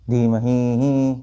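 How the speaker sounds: धीमहि with its last ही stretched to two beats; very slow धीमहि ही, final vowel held double length; long final vowel. Shown as a demonstration. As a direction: drag out the ही of धीमहि. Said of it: "वो दो मात्रा में जाएगा".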